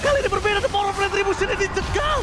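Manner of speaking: ramp up the live call; fighting game announcer. Acted. Caster hyping a team fight.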